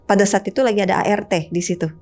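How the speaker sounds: ordinary delivery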